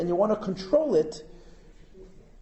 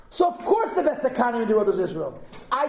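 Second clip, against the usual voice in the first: ordinary delivery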